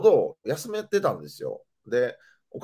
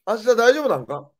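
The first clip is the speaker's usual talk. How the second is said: higher, louder motherly nagging question; a hint of an eager mother pestering; imitating mom; 9 semitones higher, 5 dB louder than usual. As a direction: play the chatty mom who won't stop asking. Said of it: "返事必要な会話をずっとしてくんのよ".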